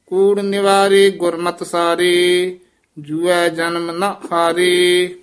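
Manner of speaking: measured, drawn-out devotional chant; scripture recitation. Shown as a demonstration. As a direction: recite steadily, holding the line endings. (ਠਹਿਰਾਅ ਵਾਲਾ ਗੁਰਬਾਣੀ ਪਾਠ)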